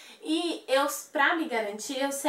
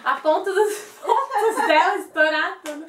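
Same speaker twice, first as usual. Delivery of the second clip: laughing; 7 semitones higher, 8 dB louder than usual. Real, not acted. Laughs telling about stitches.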